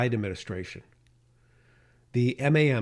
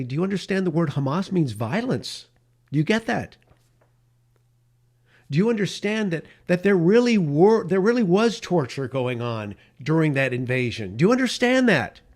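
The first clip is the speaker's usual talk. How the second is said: high, louder, rising exasperation; pleading indignation; 5 semitones higher, 5 dB louder than usual. Retold or imitated. Host lecturing imagined protesters, climbing in pitch and force. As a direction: drive the question home, building.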